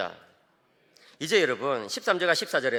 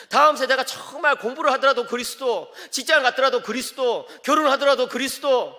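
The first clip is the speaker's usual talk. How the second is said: loud, high sermon drive; impassioned preaching; 7 semitones higher, 8 dB louder than usual. Acted. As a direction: preach it with full force. Preaching at full force. (힘찬 설교 어조)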